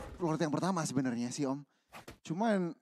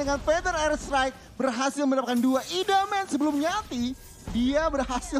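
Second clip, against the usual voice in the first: hyped play-by-play, higher and louder; fighting game announcer; 7 semitones higher, 4 dB louder than usual. Real, not acted. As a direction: call the teamfight like a caster. Caster calls the big teamfight.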